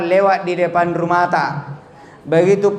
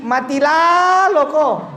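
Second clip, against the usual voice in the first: mock yell; 9 semitones higher, slower than usual. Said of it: "Ini Adam yang teriaki".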